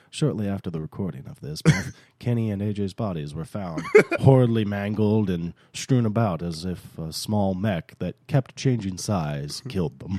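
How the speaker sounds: a hint of a grim epilogue voiceover; mock voiceover. Host mocking a movie's closing voiceover, low and grim. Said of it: "have somebody else voice it over".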